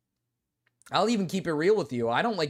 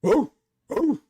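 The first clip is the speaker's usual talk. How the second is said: loud playful woofs; mock dog barks; 6 semitones higher, 7 dB louder than usual. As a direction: bark it out like a dog on all fours. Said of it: "start barking like a dog".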